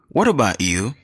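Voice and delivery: usual speaking voice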